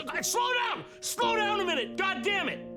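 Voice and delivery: high shouted frustration; yelling. Real, not acted. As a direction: yell at it to stop. Yelling at fast video.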